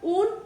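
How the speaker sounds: ऊन said high and loud with the final o dropped; ऊन cut short and high, stressed for learners; clipped uno. Shown as a demonstration. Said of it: "देखो ऊनो था".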